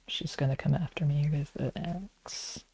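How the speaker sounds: hushed joking whisper; whispered aside. Real, not acted. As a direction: whisper it like a secret. Joking aside about friend.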